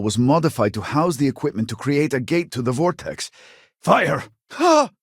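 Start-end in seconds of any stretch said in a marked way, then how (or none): 3.8-4.9 battle shout. Acted. Battle command and cry.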